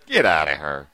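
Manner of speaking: low-pitched mock divine order; the Lord commanding. The Lord by the bed casting out a demon, low command. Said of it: "the Lord's standing by your bed".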